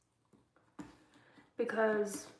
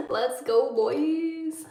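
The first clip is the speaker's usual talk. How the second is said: mock cheer; 3 semitones higher, 3 dB louder, much slower than usual. Repeats the jokey cheer, laughing.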